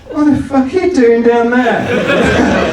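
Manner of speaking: tenant's question in a real laugh; laughing quote. Retold or imitated. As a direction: laugh your way through the line. Tenant's line, laughing through it.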